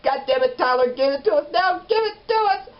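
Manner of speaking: very high, loud demanding yell; exasperated shout. Retold or imitated. Impatient viewer demands the reveal from Tyler, exasperated shout. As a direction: shriek it, each word punched.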